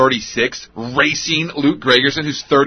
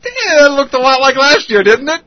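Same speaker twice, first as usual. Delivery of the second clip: high, loud exasperated jab; exasperated verdict; 11 semitones higher, 5 dB louder than usual. Acted. Host judging a first-game fielding error, exasperated.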